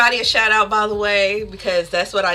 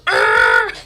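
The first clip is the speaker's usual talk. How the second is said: loud, very low imitated growl; power-up growl; 6 dB louder than usual. Fan imitates upset streamer powering up, loud growl. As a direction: growl like you're powering up at someone. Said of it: "it sounds like she's powering up at people".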